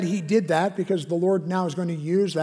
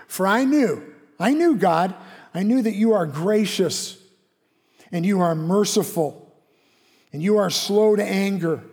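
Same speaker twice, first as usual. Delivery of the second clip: indignant complaint; 3 semitones higher, 5 dB louder than usual. Acted. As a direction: throw the words back at God. Jonah angrily complaining to God.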